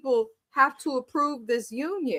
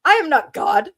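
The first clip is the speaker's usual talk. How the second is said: louder, higher stern order; mock stern command; 4 semitones higher, 7 dB louder than usual. Uncle orders the kneeling wife up, loud stern mock. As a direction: order her up firmly. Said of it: "And he said".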